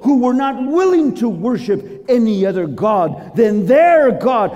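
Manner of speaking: high, loud royal decree; king declaring to the whole realm; proclaiming. Acted. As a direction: preach out the proclamation, ringing and emphatic. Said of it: "And he proclaimed".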